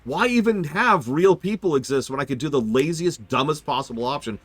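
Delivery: mocking outraged rant; sarcastic rant. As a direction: rail at lazy studios with sarcasm. Mock rant about AI.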